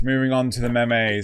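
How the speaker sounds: ordinary talk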